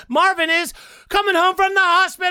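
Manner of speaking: high, shouted big news; excited shout. Acted. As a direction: announce it with a yell.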